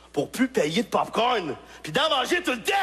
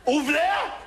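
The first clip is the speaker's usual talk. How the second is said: exasperated yell; 7 semitones higher than usual. Retold or imitated. Comic yells at the clerk to reopen the machine, exasperated. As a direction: demand he open it.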